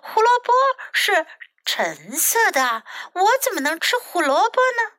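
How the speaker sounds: high-pitched indignant narrated shout. A narrator's reading. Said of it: "小鸟大喊道".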